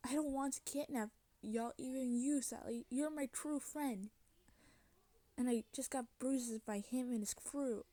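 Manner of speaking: ordinary delivery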